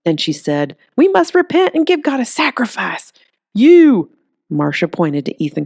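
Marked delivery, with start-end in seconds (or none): 1.0-4.1 higher, louder preaching zealot; a Bible-thumper condemning sinners; fire-and-brimstone preacher. Acted. Zealot picks a victim.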